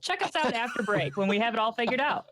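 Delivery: fast, upbeat fake commercial; joking announcer pitch; mock ad read. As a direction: rattle it off like a radio ad. Mock ad read for merch store.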